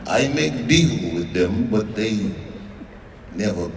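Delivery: lightly voiced Russian boss, calm and low; Russian mobster. Russian mobster explaining to the comic, low Russian accent. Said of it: "He goes".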